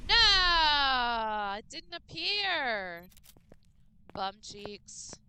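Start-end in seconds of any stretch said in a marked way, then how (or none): 0.1-1.5 sudden loud cry of dismay; real shout. Real, not acted. Streamer shouting at the game as it fails. 1.6-3.0 dismayed. Real, not acted. Game fails on stream.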